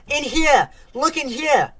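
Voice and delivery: high-pitched urgent yell; screaming. A narrator's reading. Trapped boy begs to be found, screaming. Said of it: "screamed Alec".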